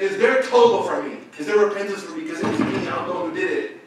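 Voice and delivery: desperate pleading. Retold or imitated. Woman asking sheikh about repentance, pleading. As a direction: plead urgently for a way back.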